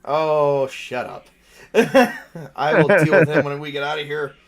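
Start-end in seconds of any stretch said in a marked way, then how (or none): none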